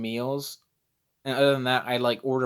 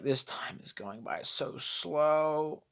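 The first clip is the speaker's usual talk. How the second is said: slow and softer, bored to bits; bored drawl; 3 semitones higher, 6 dB quieter, much slower than usual. Retold or imitated. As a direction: stretch it out as if the flight never ends. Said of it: "And we're just like".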